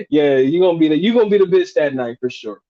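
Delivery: ordinary talk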